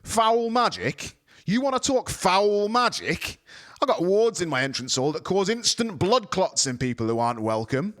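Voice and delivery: rising fury. A narrator's reading. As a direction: build the threat steadily. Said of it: "his voice rising steadily".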